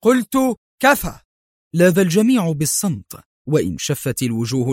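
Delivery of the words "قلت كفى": much higher, louder shout with a pause; man silencing the quarrel, loud commanding shout. A narrator's reading. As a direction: slam down the order. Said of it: "صاح مايكل".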